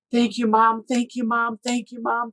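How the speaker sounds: high, tearful voice repeating thanks; retold sobbing thanks. Son hugging mom, sobbing thanks, voice high. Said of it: "starts sobbing".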